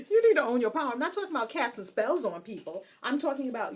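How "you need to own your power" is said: loud, high words through laughter; laughing exhortation. Real, not acted. Host urges witches to own power, laughing. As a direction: urge them on while cracking up.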